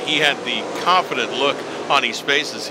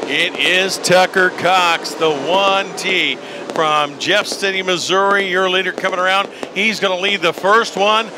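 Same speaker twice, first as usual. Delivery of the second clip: higher, lively lap-by-lap race call; motorsport commentator; 6 semitones higher than usual. Acted. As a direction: call the leaders coming around. Live race play-by-play call.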